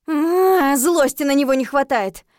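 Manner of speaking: loud, high growl of exasperation; fed up and fuming; exasperated outburst. Acted. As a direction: let the fury burst out. Narrator exasperated with the ghost.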